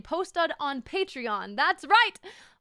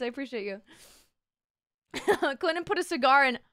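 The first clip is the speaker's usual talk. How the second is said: usual speaking voice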